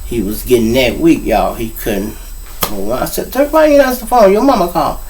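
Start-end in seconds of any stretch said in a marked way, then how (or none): 3.3-5.0 retold scolding. Scolding friend for not answering.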